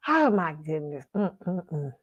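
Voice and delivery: ordinary delivery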